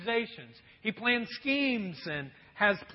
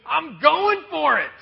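higher, louder, slowed-down vow to go for it; determined fish; 6 semitones higher, 11 dB louder, slower than usual. Acted. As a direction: commit like a fish that can't resist any longer. Fish decides to bite.

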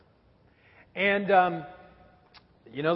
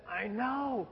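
put-on sleepy child; 4 semitones higher, 7 dB quieter, slower than usual. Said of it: "And she said".

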